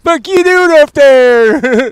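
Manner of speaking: high, loud, slow mock of the trailer line; high slow mocking parody; mocking imitation. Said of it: "quoting trailer line".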